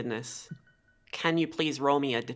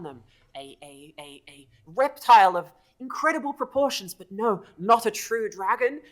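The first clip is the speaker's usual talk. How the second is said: stammering, excitable little follower; eager kobold; 5 semitones higher, 4 dB louder than usual. Acted. Kobold telling the Mighty One about the false dragon, eager. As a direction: spill the news haltingly, rising high on the denials. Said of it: "very eager to share this knowledge with you".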